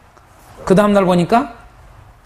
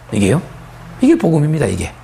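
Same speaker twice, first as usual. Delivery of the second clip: ordinary talk, nothing marked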